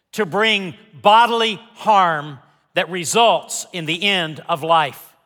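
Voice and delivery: ordinary delivery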